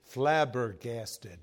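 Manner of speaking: slow, drawn-out Flabbergasted; savoring the word. Shown as a demonstration. Preacher savoring a favorite word, slowly. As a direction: stretch out Flabbergasted slowly, relishing it. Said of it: "Isn't that a good word?".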